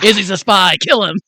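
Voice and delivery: loud, high joke shout; playfully shouted fake accusation; mock alarm. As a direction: call out a hammed-up kill order. Joke reading of the note.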